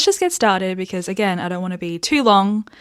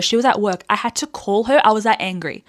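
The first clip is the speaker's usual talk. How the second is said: usual speaking voice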